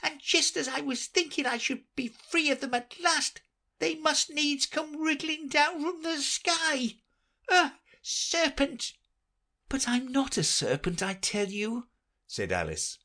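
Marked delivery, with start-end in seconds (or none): 0.0-8.9 shriek. Acted. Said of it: "raising its voice to a shriek". 9.7-11.9 put-on high, polite young girl; Alice's voice. Alice apologising to the pigeon, polite high girl voice.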